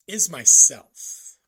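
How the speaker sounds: slow, loud, landing hard on "myself"; emphatic reveal. Real, not acted. Admitting to viewers that he is his own worst problem, said slowly and loudly.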